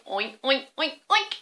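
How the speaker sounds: piggy oink oink; oinking pig noises. Shown as a demonstration. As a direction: snort it like a pig. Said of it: "Let's hear you oink like a pig".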